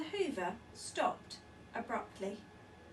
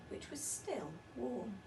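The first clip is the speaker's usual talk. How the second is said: ordinary delivery, nothing marked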